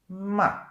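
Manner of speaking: loud "Ma" starting high and dropping sharply; fourth tone, falling. Shown as a demonstration. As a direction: hit "Ma" high, then let it fall. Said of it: "starts high and it goes down".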